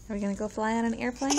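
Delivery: usual speaking voice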